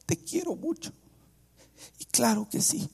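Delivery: high, soft weepy imitation; mock tearful. Mother blessing her son, mock tearful and high. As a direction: squeak it out as if crying. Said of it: "ella empieza a llorar".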